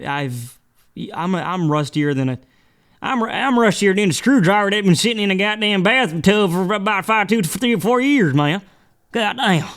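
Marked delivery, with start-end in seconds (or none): 3.0-9.7 exaggerated Southern accent, cursing; Southern drawl. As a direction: ham up a Tallahassee Southern twang. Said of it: "picking up the lingo".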